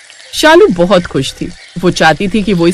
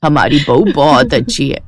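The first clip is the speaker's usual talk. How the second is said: put-on lower, gentle older woman; lightly voiced mother-in-law; 6 semitones lower than usual. Mother-in-law calming her daughter about the neighbours, low older voice. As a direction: soothe your daughter like an old mother.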